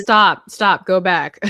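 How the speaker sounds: loud, emphatic retold interjection; mock interrupting. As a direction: cut in firmly as if halting a performance. Acts out interrupting her co-writer's reading.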